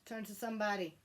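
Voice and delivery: usual speaking voice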